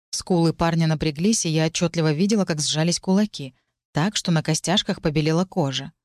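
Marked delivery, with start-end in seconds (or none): none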